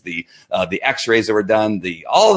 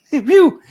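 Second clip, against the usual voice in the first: laughing whoop; 6 dB louder than usual. Real, not acted. Mock cheer after a vocal aside.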